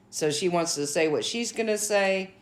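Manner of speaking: ordinary talk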